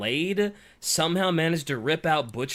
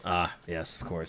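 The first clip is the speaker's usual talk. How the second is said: mock solemn; 10 semitones lower, 6 dB quieter than usual.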